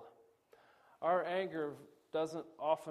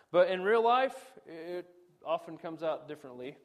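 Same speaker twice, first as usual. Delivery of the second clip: usual speaking voice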